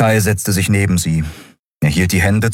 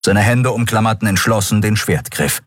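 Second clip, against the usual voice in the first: ordinary delivery, nothing marked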